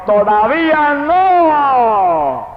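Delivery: very high, very slow cry, stretched long; booming divine shout. Retold or imitated. The voice of God waking him at night, a huge slow shout. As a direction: thunder it down like God's voice from the sky. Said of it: "y la voz me gritó".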